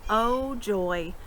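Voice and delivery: plain reading voice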